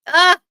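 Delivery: loud, high cry of emotion; sharp high gasp of being moved; overwhelmed cry. Real, not acted. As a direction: let out a sudden overwhelmed ah. Overwhelmed by waving audience.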